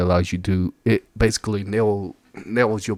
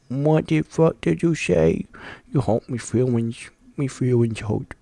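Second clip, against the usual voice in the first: mock hurt voice; 4 semitones higher than usual. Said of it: "This dude's like".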